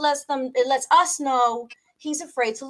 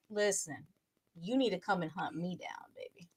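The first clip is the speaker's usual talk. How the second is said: breathy whispered come-on, very soft; seductive whisper; 6 semitones lower, 15 dB quieter than usual. Retold or imitated. Woman whispering to a man to hunt her down. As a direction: whisper it low like a tease. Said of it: "the women that just be like".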